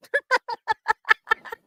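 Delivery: high bright burst of laughter. Real, not acted. Laughing with the chat about skinny claims, high laugh. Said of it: "laughing about food talk".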